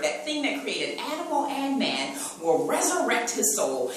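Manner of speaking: loud, ringing sermon cadence; full-force preaching. Acted. Preacher rousing the church, loud preaching. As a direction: drive every phrase home to the pews.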